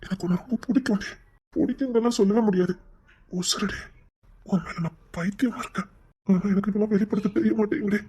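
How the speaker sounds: soft, hushed confession of love; tender confession. Acted. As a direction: murmur it, tender and sincere.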